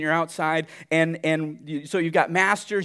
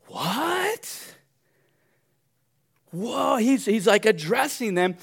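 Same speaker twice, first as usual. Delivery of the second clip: shocked what; 7 semitones higher, much slower than usual. Acted. Preacher acting shocked listener.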